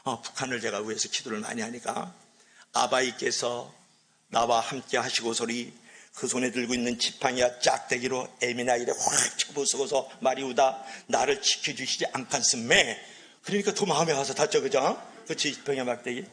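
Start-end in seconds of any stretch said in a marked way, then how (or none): 2.7-13.0 slow North Korean Hamgyong dialect; Hamgyong accent. Shown as a demonstration. Preacher performing Psalm 23 as a Hamgyong version, Hamgyong accent. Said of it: "제가 함경도 버전으로 먼저 하겠어요".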